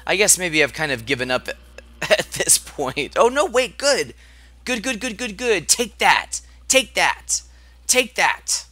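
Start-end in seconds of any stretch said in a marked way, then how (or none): 3.2-5.7 excited. Real, not acted. Excited relief in battle. 5.7-8.6 excited. Real, not acted. Attacking in battle.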